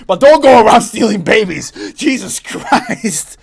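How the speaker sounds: loud, high-pitched outraged rant; real heated scolding at full volume. Real, not acted. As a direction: shout it in exasperated disbelief. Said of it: "I'm heated".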